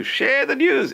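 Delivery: bright, urging lift; excited push. Real, not acted. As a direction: jump up high on "share the news".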